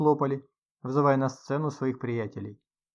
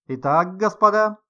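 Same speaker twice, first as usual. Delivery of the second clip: host's announcement; 6 semitones higher, 8 dB louder than usual. A narrator's reading. Host addresses the guests.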